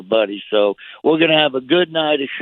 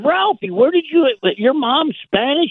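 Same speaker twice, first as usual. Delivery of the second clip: astonished question; 13 semitones higher than usual. Retold or imitated. Retelling asking his friend about his Spanish mom, high and astonished. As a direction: ask it floored and amazed. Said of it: "I'm like going".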